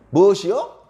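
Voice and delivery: loud, high, incredulous challenge; insolent retort. Acted. Townspeople rebuff Gideon's request for food, insolent retort. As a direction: throw it back cheekily, voice rising. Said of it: "아주 건방진 얘기를 하는 겁니다".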